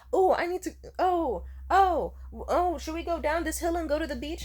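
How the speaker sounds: high, loud fretting imitation of the date; mock panicking. Woman imitating her date hesitating at the hill, panicky. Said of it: "Stop panicking".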